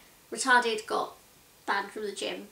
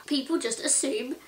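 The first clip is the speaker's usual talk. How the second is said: ordinary talk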